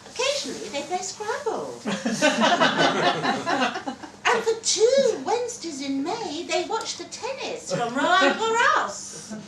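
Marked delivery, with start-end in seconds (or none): 1.8-4.2 loud, high burst of laughter; amused laugh. Real, not acted. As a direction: laugh at your own cheeky line. Narrator laughs at joke.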